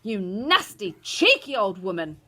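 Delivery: loud, indignant insult; father berating the old woman; angry shout. A narrator's reading. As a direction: hurl it out crossly. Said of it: "shouted Mister Jenkins".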